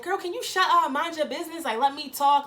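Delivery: high, sassy snap; mock snappy clapback. [name] telling Karen to butt out, high and sassy. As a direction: cut her off with attitude.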